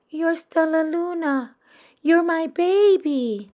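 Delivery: ordinary delivery, nothing marked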